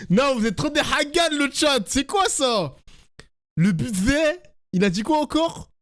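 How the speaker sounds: high, giddy, laughing voice; laughing excitedly. Real, not acted. Streamer reacts to chat mocking.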